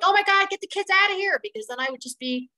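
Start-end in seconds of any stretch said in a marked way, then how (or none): 0.0-1.4 high, loud mock panic; hammed-up frantic shout; mock panicked yell. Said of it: "I didn't start yelling and screaming like".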